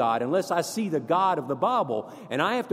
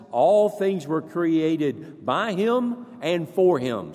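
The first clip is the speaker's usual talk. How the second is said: scripture reading; 3 dB louder than usual. Real, not acted.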